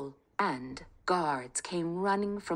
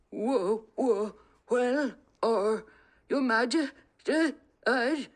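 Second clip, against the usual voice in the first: stammering, higher and louder in panic; terrified thief babbling to the king; frightened babbling; 6 semitones higher, 5 dB louder, slower than usual. Acted. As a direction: trip over the words, scared stiff. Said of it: "Seamus babbled".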